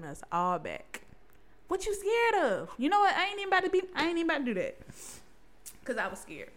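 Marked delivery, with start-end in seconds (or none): 1.7-4.8 challenging. Acted. Challenging listeners about their fear.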